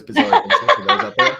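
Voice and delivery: laughing through the words, rising high. Real, not acted. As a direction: giggle out the words. Laughing at his own JoJo joke.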